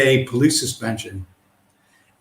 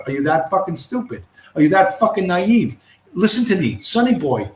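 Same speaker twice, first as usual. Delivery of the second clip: usual speaking voice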